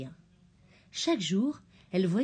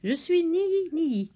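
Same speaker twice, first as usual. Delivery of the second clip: petite voix d'oiseau aiguë et lente; pinson; 9 semitones higher, 4 dB louder, slower than usual. A narrator's reading. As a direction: chante ton nom comme un pinson. Le pinson se présente. (le pinson zébré se présente, voix aiguë et lente)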